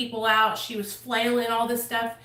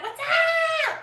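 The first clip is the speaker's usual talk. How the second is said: squealed reply. Retold or imitated. Friends answer Misty's greeting.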